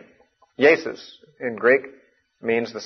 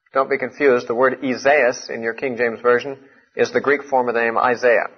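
ordinary talk, nothing marked

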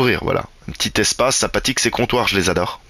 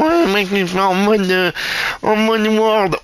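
mock viewer's complaint; 10 semitones higher, slower than usual. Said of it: "on va me dire".

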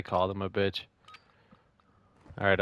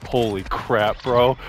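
frat bro; 5 dB louder, slower than usual. Real, not acted. Frat-bro hype reaction.

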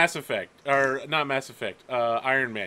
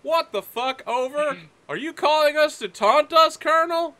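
higher and louder on the radio, outraged; angry midshipman; 9 semitones higher, 4 dB louder than usual. Acted. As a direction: challenge the colonel over the radio. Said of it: "So he's like".

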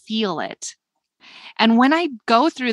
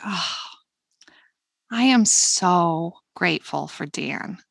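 slower, more felt; slower than usual. Shown as a demonstration. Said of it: "I really think about what I just said".

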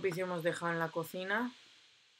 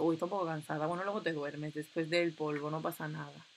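usual speaking voice

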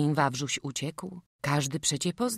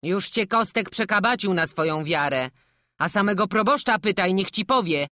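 loud, higher-pitched arguing; indignant villager; 5 semitones higher, 7 dB louder than usual. Acted. Villager insists the devil took a child's shape, loud argument. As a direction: argue insistently and loudly.

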